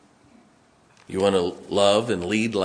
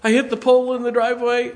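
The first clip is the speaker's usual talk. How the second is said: high, fast, louder confession; wife's voice; 7 dB louder, faster than usual. Retold or imitated. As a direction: blurt it as the flustered wife. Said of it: "what did you hit?".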